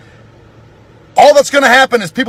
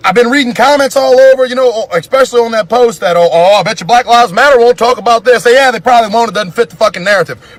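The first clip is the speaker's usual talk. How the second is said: raging at both sides; angry rant; 3 semitones higher than usual. Real, not acted. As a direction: vent it at everybody. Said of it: "I'm so fucking mad".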